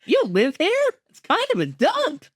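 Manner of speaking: high, put-on cartoon voice; lighthearted high-pitched imitation of the show voice; character impression. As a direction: mimic the character line way up high. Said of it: "quoting the character's line".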